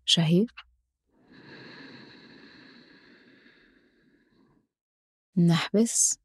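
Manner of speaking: slow, calm breathing cues; guided breathing. Acted. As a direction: count the breath slowly and softly.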